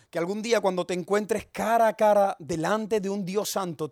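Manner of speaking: normal talking voice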